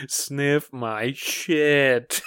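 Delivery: "Sniff my shit" drawn out very slowly; backwards speech. Retold or imitated. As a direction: stretch "Sniff my shit" slowly like reversed audio. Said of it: "it was just".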